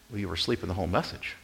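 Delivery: usual speaking voice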